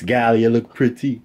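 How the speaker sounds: slow, put-on Jamaican "Gal, you look pretty"; son's patois attempt. Son copying mom in a mock Jamaican accent. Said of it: "That makes more sense".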